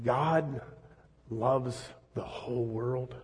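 soft and slow, letting each word land; hushed awe. Real, not acted. Preacher savouring the phrase Nicodemus found stunning, hushed and slow. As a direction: say it quietly in wonder.

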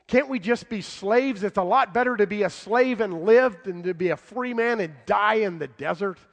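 rising complaint from the crowd, building to a loud protest; crying out. Retold or imitated. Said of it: "they cried out to Moses".

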